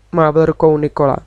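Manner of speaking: usual speaking voice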